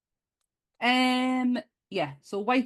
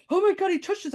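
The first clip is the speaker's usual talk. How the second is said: gasping fan; 14 semitones higher, 6 dB louder, faster than usual. Retold or imitated. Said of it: "someone would be like".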